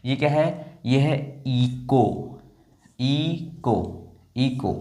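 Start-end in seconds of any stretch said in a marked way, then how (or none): 1.5-4.8 इको in two separate syllables, no H; slow इको, long first vowel then को; silent H. Shown as a demonstration. Teacher modelling for learners, slow इको with silent H. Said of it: "H जो है वो silent".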